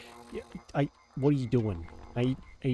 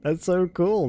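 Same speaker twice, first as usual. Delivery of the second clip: louder, higher, laughing in delight; excited delight; 5 semitones higher, 6 dB louder, slower than usual. Real, not acted. Player delighted the cats watch her, excited and louder. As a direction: gush over the watching cats.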